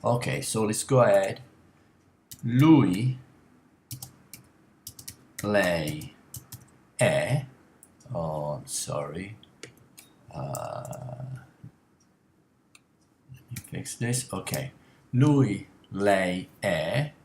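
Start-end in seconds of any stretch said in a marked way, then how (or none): 2.4-7.5 "Lui, lei, è" said slowly, word by word; Italian pronunciation. Shown as a demonstration. Teacher modelling "Lui", "lei", "è" for the class. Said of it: "Lui è means he is". 15.1-17.1 "Lui, lei, è" said slowly, word by word; Italian pronunciation. Shown as a demonstration. Teacher modelling "Lui", "lei", "è" for the class. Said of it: "Lui è means he is".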